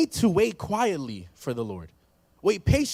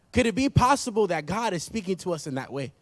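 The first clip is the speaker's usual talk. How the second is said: ordinary talk, nothing marked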